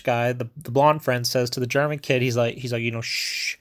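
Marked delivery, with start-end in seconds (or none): 3.0-3.6 soft, drawn-out shush; finger-to-lips shush; hushing shh. Retold or imitated. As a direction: imitate hushing someone into silence. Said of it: "putting his finger up to his lips".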